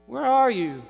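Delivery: high, rising-volume search call. Acted. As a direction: call out for someone missing. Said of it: "I've been calling for him".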